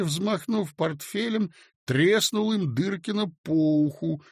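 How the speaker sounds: plain reading voice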